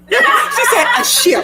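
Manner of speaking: high laughing shout; cracking up while quoting; laughing repeat of the answer. Real, not acted. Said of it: "laughing at co-host".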